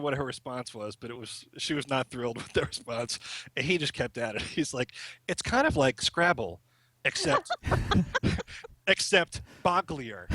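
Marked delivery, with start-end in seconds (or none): 5.3-10.3 amused retelling. Retold or imitated. Amused retelling of son's cheeky reply.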